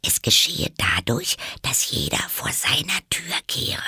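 breath-edged half whisper. A narrator's reading. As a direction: confide it in a light stage whisper. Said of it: "flüsterte Alice". (halb geflüstert)

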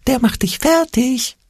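high, slow, whimpering little fear voice; timid, slow, high whimper; whimpering Angstpeter. A narrator's reading. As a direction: whine it like a frightened little goblin. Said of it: "wimmerte der Angstpeter".

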